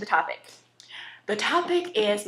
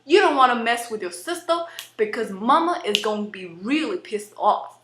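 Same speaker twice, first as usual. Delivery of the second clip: sassy lower-class US accent; lower-class American accent. Shown as a demonstration. Warning not to mess with sister, lower-class American accent. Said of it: "a lower class accent in the United States".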